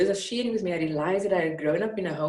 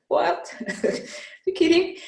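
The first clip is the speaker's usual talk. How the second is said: a startled, high "You kidding?" with a laugh; a laughing, high-pitched what, you kidding; disbelief; 12 semitones higher, 3 dB louder, slower than usual. Retold or imitated. Young man hearing Mandela's lesson, in laughing disbelief. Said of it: "I was like".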